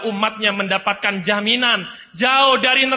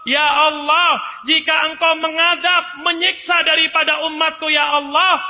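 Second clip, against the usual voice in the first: pleading shout; 7 semitones higher, 4 dB louder than usual. Acted. Isa begs God not to punish his people, loud high plea. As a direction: call out to God, begging mercy.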